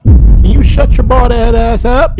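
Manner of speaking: slow, loud mock telling-off; loud scolding. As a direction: bark it at the screen. Vlogger scolding man in video.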